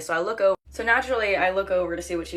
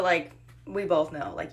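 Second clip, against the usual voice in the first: usual speaking voice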